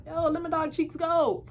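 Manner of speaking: high re-enacted demand; acted protest. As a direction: call up to the bus in mock protest. Demands friend's release from bus.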